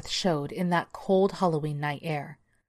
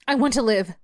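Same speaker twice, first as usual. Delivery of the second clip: put-on raised, higher girl's voice; loud, high plea; defiant cry; 6 semitones higher, 7 dB louder than usual. A narrator's reading. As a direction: cry it out loudly for your life. Girl defies the attacking beast.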